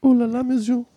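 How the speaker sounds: high falsetto French woman; flirty high French lady voice; man doing a woman's voice.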